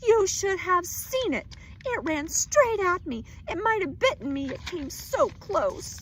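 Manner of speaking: high, loud, slowing at the end; wife telling husband about the mouse, frightened; frightened insistence. Acted. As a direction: plead how close it came.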